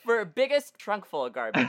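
laughing. Real, not acted. Joking guess while laughing.

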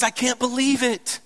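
high, emphatic mock indignation; exasperated disbelief. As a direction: play someone upset and unable to believe it. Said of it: "Oh, he's mad".

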